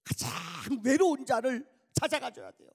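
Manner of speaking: loud, high preacher's cry; preaching at full force. Acted. Preacher urging his congregation, loud and high. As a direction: call the church to go to the lonely.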